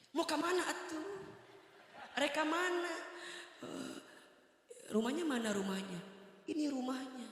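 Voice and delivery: wife's high voice asking which house; high woman's voice. Retold or imitated. Woman asks where house is.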